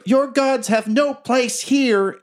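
put-on high, slow, eerie child voice; high-pitched child villain's decree; murder child voice. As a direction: pronounce it slowly as a menacing kid. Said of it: "you hear a murder child say".

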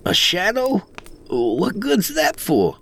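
high-pitched puzzled disbelief; incredulous. A narrator's reading. Greenhorn doubts the worth of a shadow, high and incredulous. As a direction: question it with voice jumping up.

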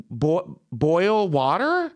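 slow, high, questioning "boil water?"; stumble, then sound out "boil water" as a rising question; puzzled echo. Retold or imitated. Baffled echo of waitress's accented words.

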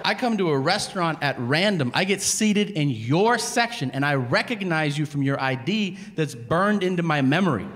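indignant, worked-up tirade; exasperated rant. Retold or imitated. Diner lectures unimpressed waiter on the wallet search, exasperated. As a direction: pile up the proof in growing disbelief.

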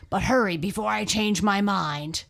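loud lion voice; lion's warning. A narrator's reading. Lion hurrying off the freed mouse, warning loudly. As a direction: warn it forcefully as the big lion.

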